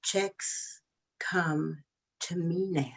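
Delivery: slow, soft mantra voice; a slow, hushed chant of the motto; special voice. Pretend. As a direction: savor each word, slow and quiet. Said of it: "I need to say that in the, this voice".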